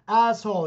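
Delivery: "Asshole" said high and loud, both syllables clear; imitated careful enunciation. Deaf pupil finally getting the word right, careful enunciation. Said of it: "finally he went".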